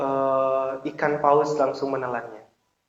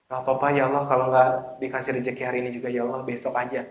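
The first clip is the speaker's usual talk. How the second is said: quick, low, offhand mumbling; half-hearted prayer; 4 semitones lower, 7 dB quieter, much faster than usual. Acted. Preacher mocks giving up on today's provision, offhand. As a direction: rattle it off softly without conviction. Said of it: "Nggak yakin".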